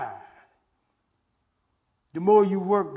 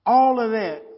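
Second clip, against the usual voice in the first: all of that flung out louder; preaching shout; 3 semitones higher, 9 dB louder than usual. Acted. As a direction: hit all of that hard, like a preacher. Preaching climax on list.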